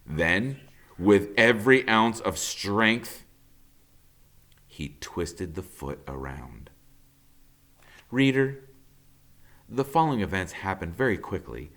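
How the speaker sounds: plain reading voice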